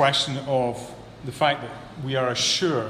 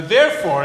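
"therefore" hit louder, falling; stressed "therefore"; 4 semitones higher, 8 dB louder than usual. Shown as a demonstration. Preacher pointing the flock at "therefore". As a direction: lean on "therefore" to flag it. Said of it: "therefores are always really important".

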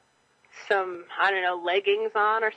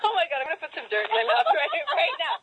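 laughing; 8 semitones higher than usual. Real, not acted. Excited about eating dirt.

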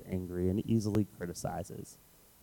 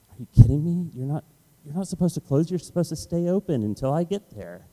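indignant disbelief; 7 semitones higher, 3 dB louder than usual. Retold or imitated. Reacting to the store closing early.

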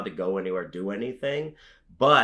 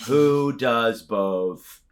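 drawn-out slow Who does both; slow deadpan repeat; 3 dB louder, much slower than usual. Acted. Host repeating the punchline question to the guest, slow deadpan.

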